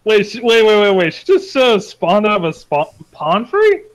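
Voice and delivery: ordinary delivery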